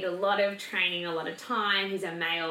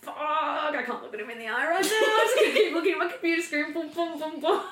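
higher, laughing, hammed-up panic; panicky inner voice; 9 semitones higher, 4 dB louder than usual. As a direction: rattle it off half laughing, eyes glued to the screen. Panicked inner monologue, avoiding dad.

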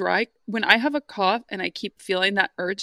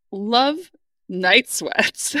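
slow, stretched answer; drawn out; 3 dB louder, much slower than usual. Real, not acted. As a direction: savour each word slowly.

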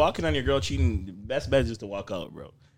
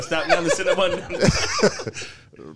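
slow, laughing mock dentist; 5 semitones higher, 5 dB louder, much slower than usual. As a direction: drag it out like a scolding dentist. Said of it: "That was your dentist telling you".

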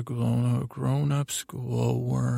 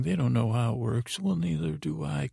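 ordinary delivery

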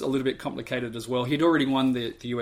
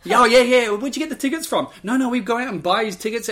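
high, loud, eager chatter; excited wife; 9 semitones higher, 7 dB louder than usual. Retold or imitated. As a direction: bubble over with excitement. Wife excited about tickets.

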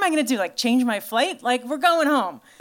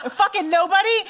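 laughing in disbelief; 7 semitones higher than usual. Real, not acted. To a silent audience, laughing and higher. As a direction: crack up while asking the crowd. Crowd doesn't relate, she laughs.

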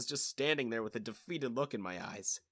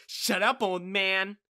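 loud, raised warning; angry silencing of an old man; hissed warning; 5 semitones higher, 6 dB louder, slower than usual. A narrator's reading. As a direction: snap it at the priest, high and loud. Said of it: "Angela warned the priest".

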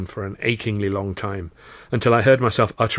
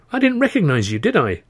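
very high, defensive protest; indignant retort; 8 semitones higher, 5 dB louder than usual. Acted. Defending oneself to an old friend, indignant. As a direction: protest at a much higher pitch.

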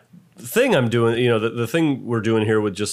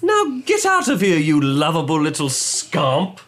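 fond scolding; 3 semitones higher, slower than usual. Acted. Fond send-off to Tig.

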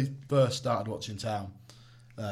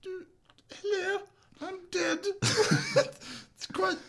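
put-on very high quavery old Queen voice; squeaky falsetto old queen; the Queen impression; 4 dB louder than usual. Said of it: "Do the Queen".

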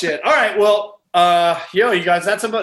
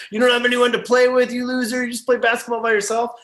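mock taunting; 7 semitones higher than usual. Teen bullies mocking a loner.

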